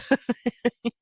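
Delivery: a quick, light laugh after the admission; short laugh. Real, not acted. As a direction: let a small laugh slip out.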